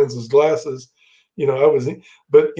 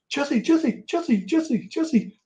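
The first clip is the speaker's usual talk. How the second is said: high, hushed pleading; whisper-beg for rescue; imitating Bobby begging Jesse for help; frantic pleading; 10 semitones higher, 6 dB quieter, much slower than usual. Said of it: "like that".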